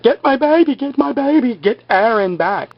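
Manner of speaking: high, pleading mock scream for the baby. Claire pleading for her stolen baby, imitated frantic cry. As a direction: imitate a frantic mother calling out. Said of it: "Claire was screaming".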